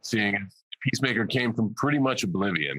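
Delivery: tongue-in-cheek, playing it straight; sarcastic. Real, not acted. Actor joking to ease the tension, sarcastic. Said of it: "being sarcastic early on".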